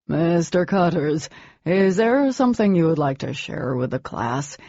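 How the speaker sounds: weary, bored teacher; tired, bored. A narrator's reading. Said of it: "he asked in a tired, bored voice".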